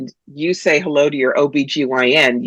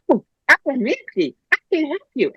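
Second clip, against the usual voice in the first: high, airy-fairy mock healer voice; fluffy healer; 11 semitones higher, 3 dB quieter than usual. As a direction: mimic an overeager spiritual helper, pitched way up. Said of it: "very fluffy healers and readers".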